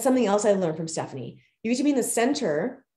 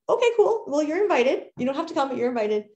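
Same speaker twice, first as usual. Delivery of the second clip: bright cheerful invite; 6 semitones higher, faster than usual. Retold or imitated. Cheerful classy reply quoted.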